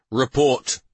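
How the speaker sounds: loud, clipped command; demanding. Acted. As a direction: bark the order at the sergeant. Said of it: "he demanded".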